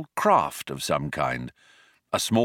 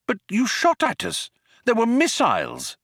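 high-pitched, affronted objection; indignant, voice pitched right up; indignant protest; 14 semitones higher than usual. A narrator's reading. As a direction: protest in disbelief. Arthur protests at being shot at.

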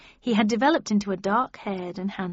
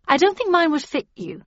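hurried, higher and louder protest; a touch hurried, raised voice; 8 semitones higher, 7 dB louder than usual. A narrator's reading. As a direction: put them off quickly, pitched up. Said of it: "I said hurriedly".

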